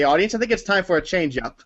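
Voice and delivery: ordinary talk, nothing marked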